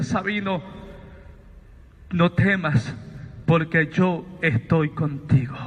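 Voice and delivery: a lightly voiced elder, low and unhurried; calm old man. Elder comforting the frightened Sabino, low and calm. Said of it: "él hablaba muy pausado, muy calmado".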